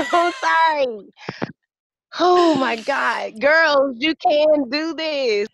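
real emotional cry of encouragement; tearful cheering. Real, not acted. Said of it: "I'm trying not to cry".

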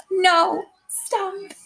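heaven's voice begging her to stop, high; heaven crying out. Acted. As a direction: cry out to halt her. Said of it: "peep through the darkness and cry".

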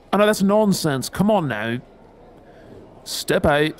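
usual speaking voice

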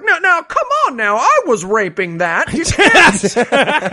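hammed-up fifties husband, pitched high; mock-protest like a fifties movie husband; nineteen fifties guy. Fifties husband objecting to the soldier, comically indignant. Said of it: "the nineteen fifties guy is like".

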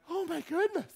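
high, scandalized mock gasp. Churchgoer seeing the pastor with a beer, high mock gasp. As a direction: clutch your pearls, way up high.

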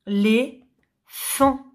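very slow "l'éléphant" without its first syllable, lé... phant; long gap inside "l'éléphant". Shown as a demonstration. Teacher repeating the puzzle, "l'éléphant" in separate syllables. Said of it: "la syllabe qui manque".

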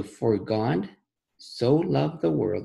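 slow, deliberate reading, word by word; slowly. Shown as a demonstration. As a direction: read it slowly so the kids catch every word. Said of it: "Let's read it slowly".

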